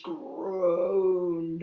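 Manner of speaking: "groaned" stretched long like creaking timber; "groaned" said slowly, as a creak; drawn-out groaned. A narrator's reading. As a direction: drag out the vowel of "groaned". Bridge groans under weight.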